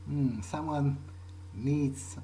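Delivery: ordinary talk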